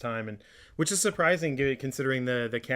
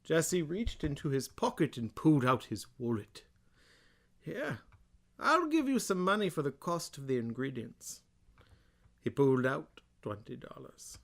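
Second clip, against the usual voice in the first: slow, hammed-up British Bane voice; Bane-style British; 3 semitones higher, 4 dB quieter than usual. Host giving dramatic reading from Full House book, mock-British Bane. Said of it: "I'm gonna do British, uh, sort of my, you know, Bane one".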